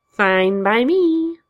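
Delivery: high, slow, drawn-out shrug; Joker voice. Acted. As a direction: drag it out, unbothered.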